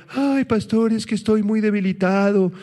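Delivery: high, whiny mock lament to the pastor; whiny complainer. Pastor mimics a worn-out member complaining to him, high and whiny. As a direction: play a tired believer moaning for sympathy. Mocking a weary church member.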